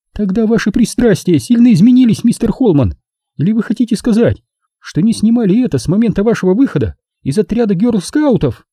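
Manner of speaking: high-pitched feminine sarcasm; mock him with formal indignation; Nina, a scornful woman. A narrator's reading. (высокий женский голос, с сарказмом)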